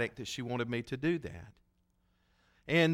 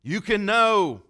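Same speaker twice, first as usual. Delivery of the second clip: slow, loud and higher, leaning on know; slow emphatic quote; 7 semitones higher, 9 dB louder, slower than usual. Retold or imitated. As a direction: hammer the certainty home slowly. Repeating Jane's firm claim.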